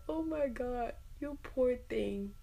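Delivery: high, drawn-out sympathetic coo; gushing pity. Retold or imitated. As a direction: pour out pity for someone hurting. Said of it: "a lot more empathetic".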